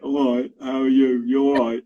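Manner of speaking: lightly imitated working-class student accent; put-on working-class accent. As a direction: mimic your son's working-class university accent. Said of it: "Like that kind of accent".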